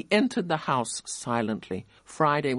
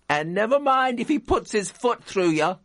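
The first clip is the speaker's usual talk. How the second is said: higher, louder mocking jeer; a mocking miner's voice; 8 semitones higher, 4 dB louder than usual. A narrator's reading. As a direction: jeer from the back of the crowd. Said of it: "said a mocking voice".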